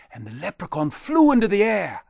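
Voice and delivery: usual speaking voice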